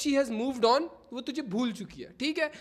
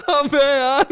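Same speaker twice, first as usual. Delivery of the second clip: exasperated yell; 2 semitones higher, 12 dB louder, slower than usual. Real, not acted. Exasperated at chat.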